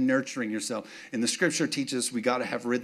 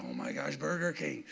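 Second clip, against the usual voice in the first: longing hush; 9 dB quieter than usual. Retold or imitated. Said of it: "I'm like".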